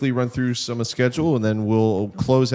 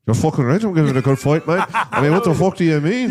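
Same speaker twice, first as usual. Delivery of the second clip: put-on brash, laughing British accent, a bit louder; 5 semitones higher, 4 dB louder than usual. Co-host shows how the British fighter really talks, in a brash British accent. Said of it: "you're so good at that".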